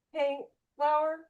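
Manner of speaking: high, soft call to a pet; calling the dog. Real, not acted. Calling to her misbehaving dog.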